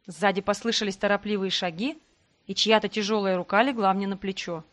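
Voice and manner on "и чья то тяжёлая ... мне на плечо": ordinary delivery, nothing marked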